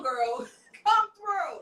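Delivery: usual speaking voice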